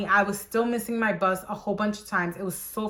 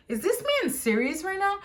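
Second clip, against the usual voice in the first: much higher incredulous voice; 11 semitones higher than usual. Retold or imitated. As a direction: ask in pure disbelief. Disbelief at boss.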